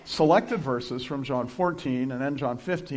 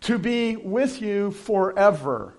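"To be with you forever" very slow and deliberate; stress on forever; 5 semitones higher, 4 dB louder, much slower than usual. Shown as a demonstration. Pastor underlining a key word, very slow. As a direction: spell out "forever" slowly. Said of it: "that's an important word".

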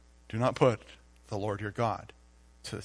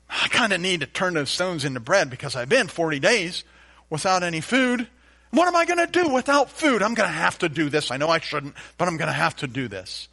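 mock whiny tempted believer, higher and louder; higher, louder voice of someone caving; 6 semitones higher, 6 dB louder than usual. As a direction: act out a fretful believer talking himself into it.

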